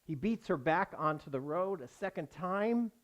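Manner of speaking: ordinary talk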